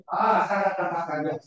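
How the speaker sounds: normal talking voice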